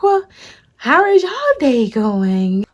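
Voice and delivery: fake-casual nervous small talk. Imitating the scared lady making small talk, slow. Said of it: "I can tell she was scared".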